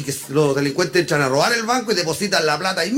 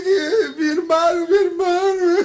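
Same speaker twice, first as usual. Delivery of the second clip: high, slow, sobbing whine; hammed-up tearful wail; mock crying; 11 semitones higher, slower than usual. Crying friend telling of his brother, mock crying. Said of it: "venía llorando y dice".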